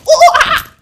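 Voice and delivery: very high, loud startled chimp shriek; chimp fright cry. Pretend. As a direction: leap back with a chimp squeal. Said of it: "we go".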